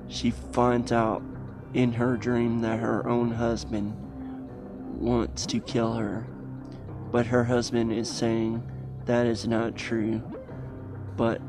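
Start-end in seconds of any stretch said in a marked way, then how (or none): none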